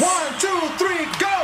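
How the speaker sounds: high loud count-in yell; shouted count-in. Acted.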